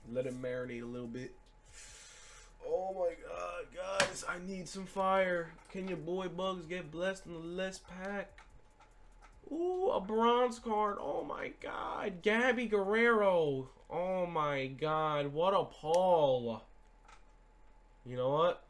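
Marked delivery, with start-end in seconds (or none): 2.7-8.4 high-pitched, whiny plea; pleading for luck. Real, not acted. Pleading for luck, last pack. 9.5-16.6 drawn-out, high-pitched fake thrill; mock excitement. Mock thrill at a bronze card.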